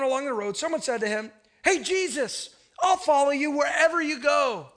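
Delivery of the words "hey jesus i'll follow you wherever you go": eager, raised-pitch call to Jesus; eager follower. Acted. Eager man hailing Jesus on the road, excited. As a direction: pledge it loudly and brightly.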